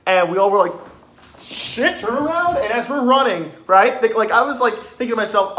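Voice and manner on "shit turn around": mock alarm. Group sees clown round corner.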